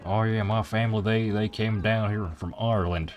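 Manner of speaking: hammed-up Texan-style Southern accent; thick southern accent. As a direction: parody a proud Irish-American in a thick Southern accent. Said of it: "in, like, the thickest southern accent".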